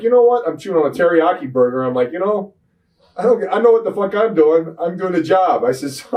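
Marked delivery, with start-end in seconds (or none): 0.0-0.5 angry outburst. Retold or imitated. Retold backstage confrontation with agent. 2.1-5.6 angry outburst. Retold or imitated. Retold backstage confrontation with agent.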